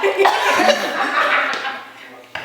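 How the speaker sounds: high, loud villain laugh; evil laugh. Pretend. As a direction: cackle like a cartoon villain. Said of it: "aquela minha risada maléfica".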